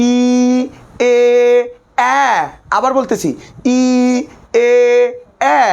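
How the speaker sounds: each vowel ই, এ, এ said apart with lips stretched; vowel drill. Shown as a demonstration. Teacher drilling the class on the vowels ই, এ, এ with lips stretched. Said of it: "সকলে ভাইয়ের সাথে উচ্চারণ করি".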